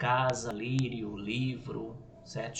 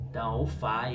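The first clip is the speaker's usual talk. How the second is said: usual speaking voice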